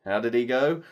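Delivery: usual speaking voice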